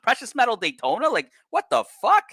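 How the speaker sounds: can't believe anyone would buy that; incredulous outburst. Real, not acted. Mocking the old market view.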